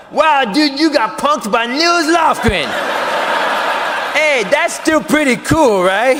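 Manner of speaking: high, loud, drawn-out taunt; mocking jeer. Taunting himself for getting punked, mocking jeer. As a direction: rub it in loudly.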